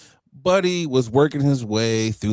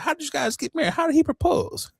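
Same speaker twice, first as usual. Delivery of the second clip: high-pitched put-on questioning voice; curious onlookers; faster than usual. Imagined people asking about the proposal, curious high voice. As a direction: pipe up high as nosy onlookers.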